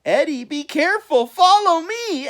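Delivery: high, loud squawk. A narrator's reading. Al warns Eddie, high squawk. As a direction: squawk a warning. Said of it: "Al squawked".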